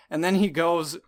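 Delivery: ordinary talk, nothing marked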